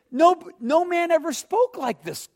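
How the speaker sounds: high, loud, mildly hammed-up astonishment; amazed report. Temple officers explain returning without Jesus, amazed and high-pitched.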